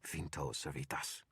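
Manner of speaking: slow, theatrical incantation; a wizard casting with a hiss; dramatic hissed spell. A narrator's reading. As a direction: wizard hissing a spell to a staff, slowly. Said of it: "hissed in my best dramatic voice".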